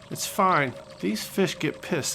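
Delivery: ordinary delivery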